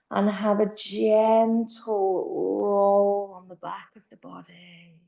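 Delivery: calm, gentle yoga teacher. Acted. Yoga teacher guiding Shavasana.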